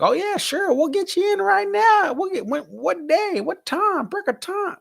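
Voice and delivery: very high-pitched mock Wisconsin accent; imitating Wisconsin staff in a high, exaggerated accent; badly faked Wisconsin accent. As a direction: put on a squeaky, hammed-up Wisconsin accent. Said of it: "I can't do the accent of the, the Wisconsin accent".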